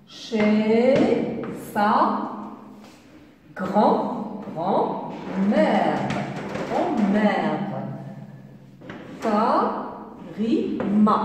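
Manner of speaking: put-on slow syllabic reading for young readers; syllable by syllable. Shown as a demonstration. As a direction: break the sentence into slow separate syllables. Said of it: "trois syllabes".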